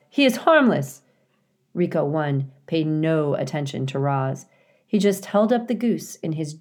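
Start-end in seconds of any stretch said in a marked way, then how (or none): 0.1-1.0 high, loud insistent plea; begging. A narrator's reading. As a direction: plead urgently in your child's defence. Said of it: "begged Roz".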